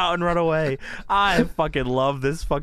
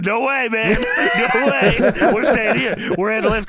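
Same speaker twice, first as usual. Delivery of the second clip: raised, loud mock protest; insistent refusal; 6 semitones higher, 5 dB louder than usual. Host voicing wrestler refusing help, loud insistent protest. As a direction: wave off the help, high and forceful.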